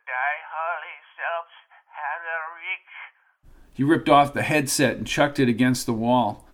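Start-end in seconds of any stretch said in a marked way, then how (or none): 0.1-3.7 put-on quiet, crackling old man on a phone; a thin, shaky old voice down the line. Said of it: "a shaky old voice crackled into his ears".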